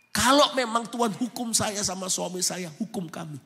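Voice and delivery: rising to a loud, high, anguished plea; desperate prayer. Retold or imitated. Mother pleading with God for her daughter, in a high anguished cry. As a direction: beg God to spare the child.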